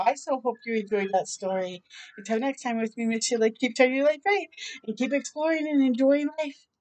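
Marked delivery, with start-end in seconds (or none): none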